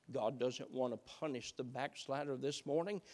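ordinary talk

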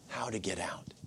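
soft, hushed and low; whisper. Acted. Preacher whispering the escape route to the congregation. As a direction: let it fall to a secret whisper. Telling how to get out secretly.

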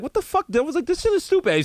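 high-pitched disbelief; shocked outrage. Retold or imitated. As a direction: squeal in disbelief. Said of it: "you're like".

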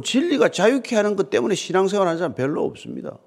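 ordinary delivery

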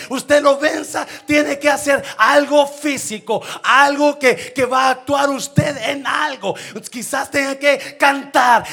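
loud, high-pitched preacher's shout; fiery preaching. Acted. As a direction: preach it at full force to the church. Preaching at full force. (predicación a todo pulmón, alta y fuerte)

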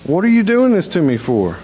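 an animal protesting its beating; startled donkey voice. Retold or imitated. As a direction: give the donkey an indignant, high cry. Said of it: "it turns around and it goes".

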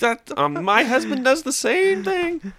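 high-pitched put-on mom voice; fan mom impression voice. Mom asking comedian for his joke, fan mom voice. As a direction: play the eager fan far up high.

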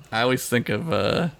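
ordinary talk

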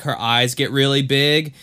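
usual speaking voice

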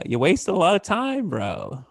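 high, slow mock-God reproach; chiding God voice. God judging him at pearly gates, mock chiding. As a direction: draw out a playful scolding from God. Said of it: "to hear God say".

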